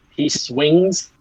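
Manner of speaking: ordinary delivery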